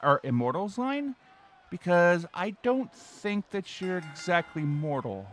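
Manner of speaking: polite call center rep, sing-song; customer service voice. Acted. Bob continues support call.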